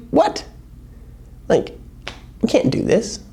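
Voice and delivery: high, incredulous what; disbelieving disciples. Retold or imitated. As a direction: play the stunned disciples.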